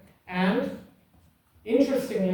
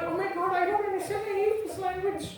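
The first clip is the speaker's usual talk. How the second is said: high, fast mock-panicked student voice; squeaky-high fretting in a rush; 12 semitones higher, faster than usual. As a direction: mimic an overwhelmed student, pitched way up. Mimicking a confused student on exam.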